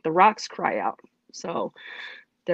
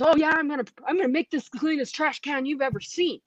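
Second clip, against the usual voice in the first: retold defiant rant, pitched higher; mad; 6 semitones higher than usual. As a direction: vow it in mock fury, voice raised in pitch. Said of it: "And I'm mad".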